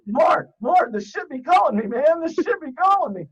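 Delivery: high, loud mock junkie plea; mock addict craving. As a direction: wail it like a hooked fiend.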